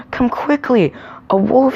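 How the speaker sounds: high, urgent storybook shout; shouted alarm. A narrator's reading. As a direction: raise the alarm, high and loud. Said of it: "he shouted".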